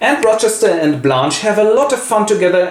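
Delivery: ordinary talk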